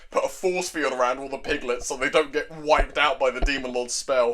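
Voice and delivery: ordinary talk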